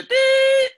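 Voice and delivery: long, loud, high-pitched "dee"; sustained high test-tone imitation; imitated ringing tone. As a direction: hold a piercing beep like ringing ears. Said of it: "It was at the same pitch".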